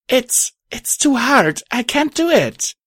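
high, halting, exhausted protest; desperate high-pitched plea; desperate strain. A narrator's reading. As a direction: falter as if about to give out. Drained heroine nearly drops the shield.

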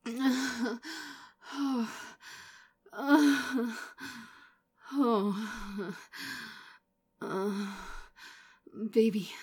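loud slow moans between pauses; panting moans. Acted. Lover pulling back from the edge, panting moans. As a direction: ride out the moment in long moans. Catching breath after stopping.

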